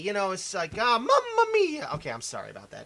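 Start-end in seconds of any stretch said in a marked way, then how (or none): 0.8-1.9 high-pitched cartoon Italian cry; hammed-up Italian exclamation, pitched way up; mock Italian exclamation. Jokey Mario-style Italian exclamation.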